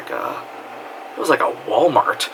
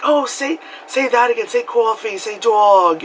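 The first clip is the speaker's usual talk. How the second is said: mimicked New York accent; 9 semitones higher than usual. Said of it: "they'll hear us say things".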